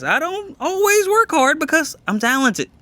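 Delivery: cocky. Real, not acted. Cocky boast, laughing.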